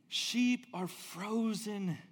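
normal talking voice

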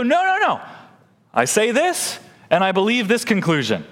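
high, emphatic no no no; mock insistence. Pastor voicing the objector, insisting high.

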